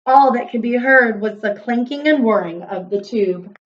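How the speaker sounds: ordinary delivery, nothing marked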